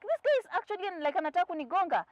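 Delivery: high-pitched, rushed mock alarm; alarmed retelling. Driver seeing matatu by her mirror, high alarmed voice. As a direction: pitch jumps up as if about to be hit.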